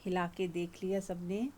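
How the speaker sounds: soft, gentle relaxation guidance; soft meditation voice. Acted. Teacher easing the group's bodies, soft guided meditation. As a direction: let the words settle them down.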